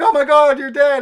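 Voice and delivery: high, loud mock disbelief; shocked outcry. Retelling Roger's fake death in the film, mock shock.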